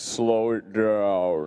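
very slow, deep drag. Shown as a demonstration. Co-host teasing about slowing down, deep slowed voice. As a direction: drop low and stretch every word like slowed tape. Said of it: "your voice gets deeper".

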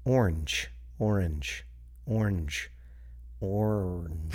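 "orange" repeated softly as or-ange, two syllables; quiet repeated "orange", two syllables; testing two-syllable orange. Shown as a demonstration. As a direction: try "orange" over and over, trying it out. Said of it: "How do I pronounce it?".

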